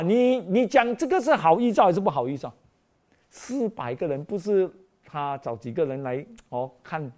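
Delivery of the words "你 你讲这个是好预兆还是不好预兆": usual speaking voice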